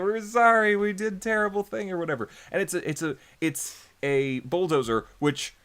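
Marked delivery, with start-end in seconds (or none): 0.0-1.9 high-pitched fake apology; mock pleading. Villagers bowing to the god of wrath, mock pleading. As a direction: beg lightly for mercy. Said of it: "They're, like, bowing to it".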